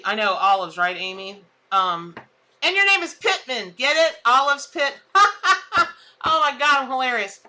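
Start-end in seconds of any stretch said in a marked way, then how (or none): 5.0-5.9 a loud, high burst of real laughter; laughing at own joke. Real, not acted. Host laughing at her own olive pun, loud laugh. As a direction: crack up at your own pun.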